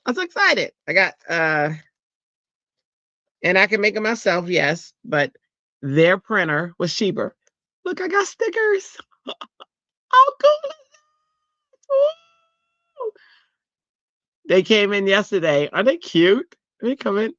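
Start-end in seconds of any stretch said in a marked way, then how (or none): 0.1-0.7 jumps way up high on the repeat; squealing excitement. Real, not acted. Showing viewers her new stickers, squealing excitedly. 7.9-13.1 very high, squealing and slow; giddy squeal. Real, not acted. Showing off new stickers to chat, squealing with joy.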